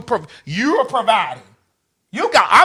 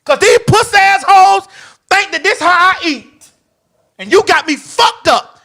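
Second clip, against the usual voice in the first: high, loud indignant tirade; indignant rant; 8 semitones higher, 3 dB louder than usual. Real, not acted. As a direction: go off on them, pitched high. Ranting at those who underestimated her.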